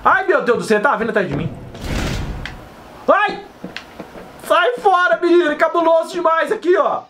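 high panicked cries; frightened, fleeing the guard; being chased. Real, not acted. Deixa eu ver se ele tá vindo atrás de mim.

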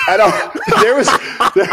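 loud high laugh breaking into words; bursting out laughing. Real, not acted. Cracking up as he retells the crack punchline. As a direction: crack up mid-sentence.

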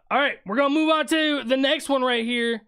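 ordinary delivery

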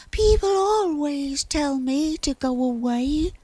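high-pitched sulking little cloud; hard-done-by high character voice; sulky. A narrator's reading. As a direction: whine it in a high, self-pitying voice. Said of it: "sulked Cloud".

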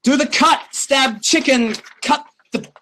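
high, halting broken English; comic high-pitched broken English with pauses. Retold or imitated. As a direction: grope for words in mock pidgin. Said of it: "I'm like".

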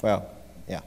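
normal talking voice

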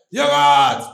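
deep, loud hawker cry; low hawker call. Retold or imitated. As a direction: bellow it low at the car. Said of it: "it's like".

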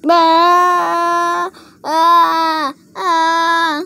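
loud, long, high play wail; mock scream. Toys spotting zombies, loud mock-scared screaming.

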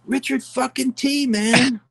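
high, slow, emphatic retort; young player's answer. Retold or imitated. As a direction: spell it out high and slow like it's obvious. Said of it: "He goes".

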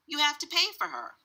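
high, emphatic demand; insistent cashier. A narrator's reading. Cashier tells father to pay for Taya, insistent. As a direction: insist on getting paid.

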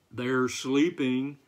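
a touch of horse, drawn out slowly; lightly voiced horse. A narrator's reading. Horse telling the farmer about the hens, lightly voiced and slow.

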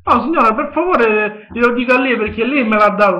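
usual speaking voice

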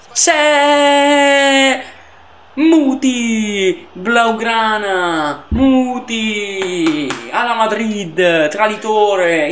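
high, drawn-out cries of a heated pundit; impassioned Italian pundit, stretched slow shouts; Lele Adani commentator. Acted. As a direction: bellow the names like Lele Adani calling the game. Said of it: "oggi sto in mood Lele Adani".

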